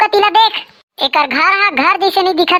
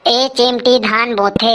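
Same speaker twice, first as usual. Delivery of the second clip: slow dictation; 7 semitones lower, slower than usual. Acted. Repeats answer while writing.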